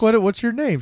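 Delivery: lightly voiced eager devil; mock eager dad. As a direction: ask eagerly, hammed-up. Satan asks daughter's name.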